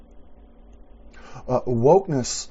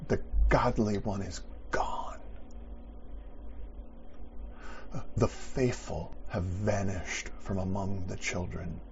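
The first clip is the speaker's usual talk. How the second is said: quiet reflective preaching; 9 dB quieter than usual. Acted. Quiet reflective close of sermon.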